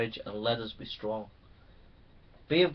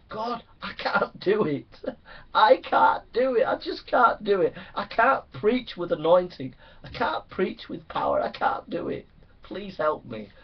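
desperate prayer; 6 semitones higher, 4 dB louder than usual. Acted. Pastor acting out desperate prayer.

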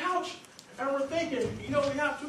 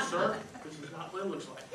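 suddenly low, soft explanation; sheepish cover-up; 7 semitones lower, 7 dB quieter than usual. Acted. Caught by a man in the bathroom, sheepishly hushed. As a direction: drop to an embarrassed, careful voice.

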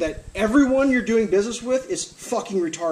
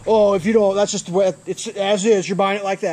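louder mimicked salesman brush-off; mock seller excuse; 2 semitones higher, 6 dB louder than usual. Seller defending as-is sale to buyer, mock dismissive. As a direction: rattle off the excuse loudly.

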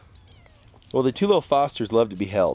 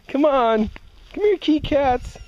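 calling kitties; 13 semitones higher, slower than usual. Real, not acted. Calling kittens to come.

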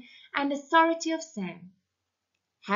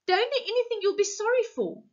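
pleading in fear; 13 semitones higher, faster than usual. Acted. Husband begging his armed wife, frantic and high.

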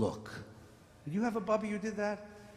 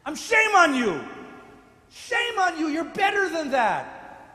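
loud, high scolding; shaming rebuke; 8 semitones higher, 8 dB louder than usual. Acted. Abraham scolding God, loud and high. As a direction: shame on you, hurled loud. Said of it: "Abraham says to God".

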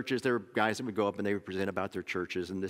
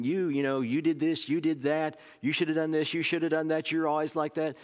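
higher, rapid-fire blaming; accusing rant; 5 semitones higher than usual. Retold or imitated. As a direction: jab each you did at someone. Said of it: "do I start saying".

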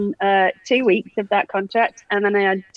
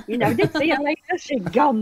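ordinary talk, nothing marked

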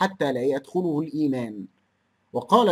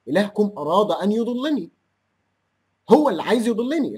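indignant protest; 4 semitones higher than usual. Real, not acted. (احتجاج غاضب بصوت أعلى وأقوى)

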